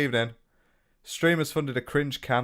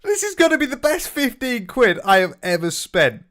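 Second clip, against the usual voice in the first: high, loud excitement; delighted outburst; 10 semitones higher, 5 dB louder than usual. Real, not acted. Buyer reacting to the bought cameo, delighted. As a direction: exclaim it loudly, pitched right up.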